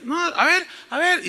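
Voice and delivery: high-pitched mock defiance; defiant challenge. Imitating people who challenge God, high and defiant. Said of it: "y desafían a Dios".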